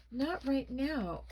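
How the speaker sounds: slow, high, strained put-off; a touch of a croak, drawn out; mild croak. A narrator's reading. Boy keeps Mom out of his room, croaking mildly. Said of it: "I croaked".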